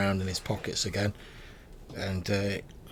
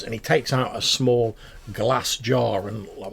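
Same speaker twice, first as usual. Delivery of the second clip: ordinary talk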